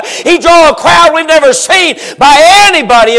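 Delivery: fiery preacher climax; full-force preaching. Acted. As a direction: build the sermon to a shout.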